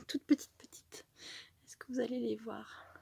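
hushed, secretive whisper. Real, not acted. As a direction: lean in and whisper it. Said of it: "Moi, je parle plus bas".

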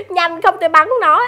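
ordinary talk, nothing marked

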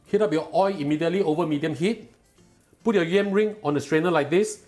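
usual speaking voice

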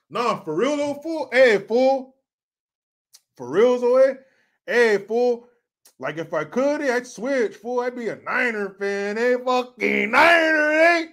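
put-on Chicano homeboy accent. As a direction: plead in a Chicano accent, building to a shout. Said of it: "I can do that accent so well".

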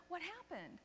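gently acted shocked concern; hushed concern. Flight attendant to returning passenger, hushed concern.

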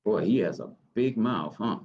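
usual speaking voice

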